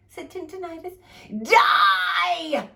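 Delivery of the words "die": high, loud yell; angry fairy shouting louder at a deaf fairy; shouted. Acted. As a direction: scream it in her deaf ear. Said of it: "shouted the garlicky one".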